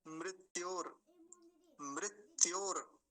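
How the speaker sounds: मृत्योर slowly as mrit-yor; clear ri. Shown as a demonstration. Teacher introducing the next word, clear ri. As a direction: sound ri after m in मृत्योर. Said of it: "पहले तो मकार पर रिकार है".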